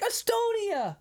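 "Estonia" cried out very high; triumphant exclamation. Retold or imitated. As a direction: seize on "Estonia" as the perfect answer. Son seizes on the guess.

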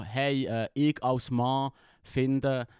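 slow, drawn-out online poster; slow mock commenter. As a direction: spell it out slowly, mockingly. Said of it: "sie fangen irgendwie an so".

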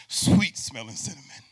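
loud, ringing "Sweet smelling cinnamon"; booming preacher call of "Sweet smelling cinnamon"; God's loud proclamation. Acted. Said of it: "And God says".

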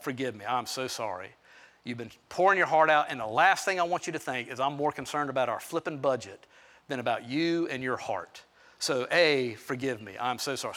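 low, soft, sincere; tender apology. Retold or imitated. Husband calls his wife to ask forgiveness, tender. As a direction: apologise gently, voice dropped low.

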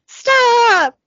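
high, loud drawn-out shout; hammed-up desperate yell; acted yell. Driver orders the runaway truck, yelling high and long. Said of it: "Billy yells".

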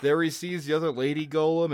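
usual speaking voice

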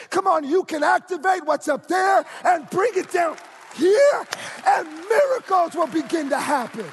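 loud, rising sermon climax building to shouts; fiery pulpit preaching; full-force preaching. Acted. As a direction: preach it hard and lift the room.

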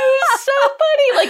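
very high, squealed through laughter; slow, high-pitched laughing delivery. Real, not acted. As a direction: crack up while getting the words out. Cracking up over the joke.

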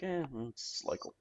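quiet, half-hearted "eh"; indifferent shrug. Retold or imitated. Australian fan on local cricket, indifferent mumble. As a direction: mumble it like you don't care. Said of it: "you're like".

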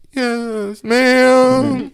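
high, put-on obedient yes; meek answer. Boy answering his uncle, high and obedient. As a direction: answer like a chastened kid. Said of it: "And I'm like".

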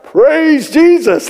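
high, drawn-out comic praise; trying harder. Retold or imitated. Young man tries again for the elder, strained praise. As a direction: stretch the words out high and strained. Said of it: "like this".

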